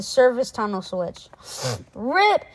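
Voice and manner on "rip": high, loud call; shout. Acted. Alexander shouting to get Rip's attention. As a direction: call him across the car. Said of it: "he shouted".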